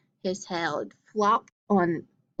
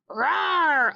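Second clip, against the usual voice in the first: bear growl; 7 semitones higher than usual. A narrator's reading. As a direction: let out a big storybook bear roar. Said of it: "Old Bear growled".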